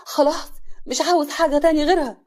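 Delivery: loud, worked-up demand, rising in pitch; agitated. Acted. Hassan demanding his wife back, loud and agitated. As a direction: insist heatedly, building to the end. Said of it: "حسن بعصبية".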